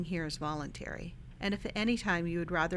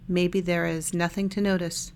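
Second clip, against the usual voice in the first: calm body-scan guidance with long silences between phrases; unhurried, even meditation-guide voice; guided meditation; 4 dB louder than usual. Real, not acted. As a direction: lead listeners gently through a meditation, leaving lots of space.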